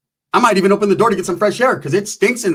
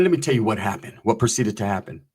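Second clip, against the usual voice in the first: ordinary delivery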